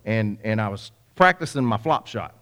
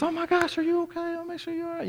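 very high, fussing mother voice; doting mom; 4 dB quieter than usual. Retold or imitated. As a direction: imitate a worried mom fussing over her child in falsetto. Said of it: "she's loving on me and so forth".

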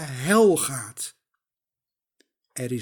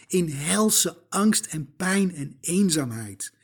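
ordinary talk

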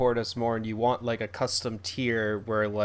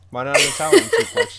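louder, higher, amused question; emphatic repeat; 5 semitones higher, 9 dB louder than usual. Real, not acted. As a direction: repeat it loudly for someone who missed it. Repeats joke louder after "What?.

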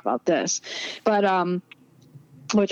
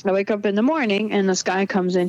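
normal talking voice

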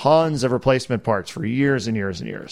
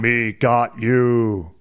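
slow pinball robot voice; 3 semitones lower, much slower than usual. Retold or imitated. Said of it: "it only said seven words".

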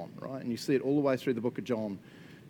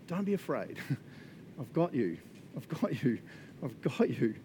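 gentle comfort; 5 dB quieter than usual. Pretend. Jesus comforting collapsed John.

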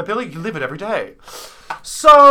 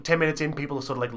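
ordinary talk

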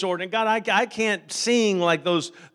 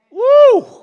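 high, loud joyful whoop; exultant woo; 15 dB louder than usual. Real, not acted. Preacher whooping at the climax, high and loud.

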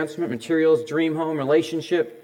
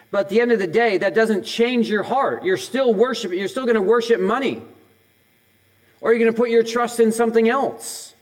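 higher, louder pulpit push; full-force preaching; 5 semitones higher, 4 dB louder than usual. Acted. Pastor pressing the congregation on money idols, full-force preaching. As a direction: drive it home to the congregation.

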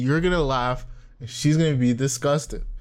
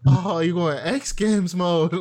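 put-on slow, drawn-out meme kid line, a bit higher; meme kid quote; 5 semitones higher, slower than usual. Quoting the meme kid to a friend, slow and drawn out. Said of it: "Kid goes".

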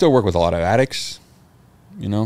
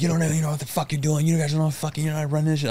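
retold yelling; 9 semitones higher, faster than usual. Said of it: "he started yelling at us again".

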